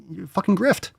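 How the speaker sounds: ordinary delivery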